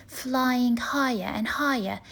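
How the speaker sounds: plain reading voice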